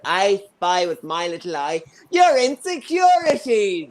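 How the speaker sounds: lightly imitated mother playing a game; mock mother. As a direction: tease like a gleeful mum, building to the jab. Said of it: "My mother's favorite was I Spy".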